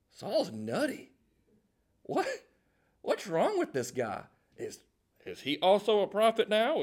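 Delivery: mock disbelief from a gossiping onlooker; sarcastic. Onlookers outside the seminary gossip about Saul, sarcastic. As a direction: scoff in lightly acted bafflement. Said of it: "read it with a good bit of sarcasm".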